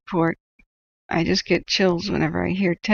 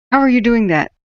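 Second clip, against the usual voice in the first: loud, higher challenging question; mock demanding mod; 4 semitones higher, 6 dB louder than usual. Mod in chat demanding he explain, loud challenge. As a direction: bark the question like a pushy mod. Mods questioning him, voiced.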